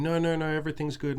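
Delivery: imitating the girl. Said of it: "And she's going".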